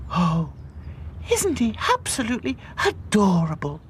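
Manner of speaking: high, cooing doll voice; sighing adoringly. A narrator's reading. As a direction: coo over the puppy. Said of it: "sighed Princess".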